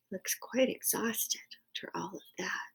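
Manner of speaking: whispered aside. Real, not acted. Reader whispering about tired Owl, whispered. Aside to listeners over picture.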